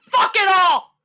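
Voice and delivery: a cracking, loud cry of rage; anguished outburst. Acted. Character raging at the complacent, crying out. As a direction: let it break into a furious cry.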